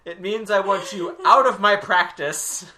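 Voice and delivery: loud, high mock therapist; a hint of a stern therapist. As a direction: play a fed-up therapist.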